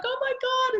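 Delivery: high, delighted exclamation; excited gasp. Retold or imitated. Mum hearing her daughter's news, delighted exclamation. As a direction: react with joyful shock.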